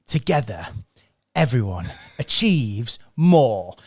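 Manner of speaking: slow, drawn-out announcement of the slogan, lightly acted; a hint of a trainer unveiling a key lesson, each word stretched; trainer's slogan. Trainer presenting acronym to room.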